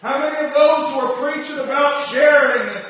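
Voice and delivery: loud high rising preacher cadence; preaching at full force. Acted.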